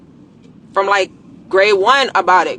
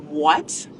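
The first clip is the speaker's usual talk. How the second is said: normal talking voice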